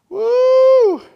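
high, loud preacher's whoop of joy; joyful whoop. Real, not acted. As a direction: let out a rising cry of joy.

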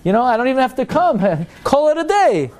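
high-pitched comic brush-off; breezily dismissive. Acted. Comic-book reader shrugging off shul, breezily dismissive. Acts man dismissing the whole service.

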